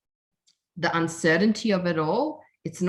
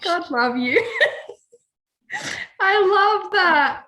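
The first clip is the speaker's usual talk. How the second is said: laughing; 11 semitones higher, 4 dB louder than usual. Real, not acted. Laughing at friend's old handle.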